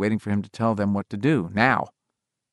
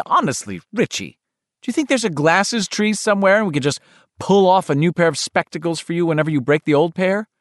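scolding mother; 7 semitones higher than usual. Acted. Mother scolding her son.

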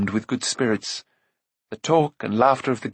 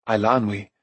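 ordinary delivery

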